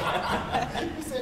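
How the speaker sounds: a breathy, high burst of laughter; real laugh. Real, not acted. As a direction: laugh out at your own nerve. Laughing at her own boldness.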